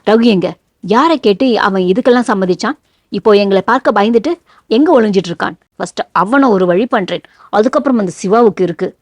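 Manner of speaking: usual speaking voice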